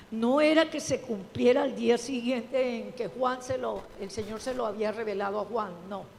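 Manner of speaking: usual speaking voice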